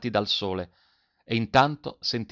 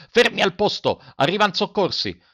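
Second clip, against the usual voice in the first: high, loud commands, easing slightly; rallying shout; 6 semitones higher, 4 dB louder than usual. A narrator's reading. Captain to his men under fire, rallying shout. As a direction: shout to hold the men in place. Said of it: "gridò il capitano".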